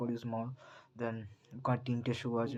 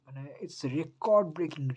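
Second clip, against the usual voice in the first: slow, high and loud "record breaking"; drawn-out thrilled "it's record breaking"; excited hype; 5 semitones higher, 5 dB louder, much slower than usual. Real, not acted. Hyping record advance sales.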